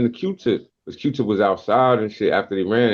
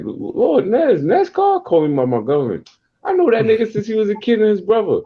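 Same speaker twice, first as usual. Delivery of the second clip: put-on high, surprised old friend voice; excited recognition; 12 semitones higher than usual. Kenny recognizing an old friend's kid, high and excited. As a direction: raise the voice, amazed he knows you.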